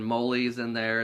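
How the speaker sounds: normal talking voice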